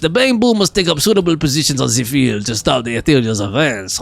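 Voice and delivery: hammed-up mock French accent; bad French accent. Streamer reading exam rules to chat as Marcel, in a bad French accent. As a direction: read it out in a horribly faked French accent. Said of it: "that was my horrible attempt at a French accent".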